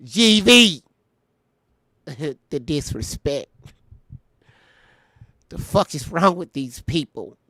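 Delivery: half-hearted mock imitation of her, shouting the name. Reading her message aloud in a lightly mocking imitation of her voice. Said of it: "I can read it in her voice".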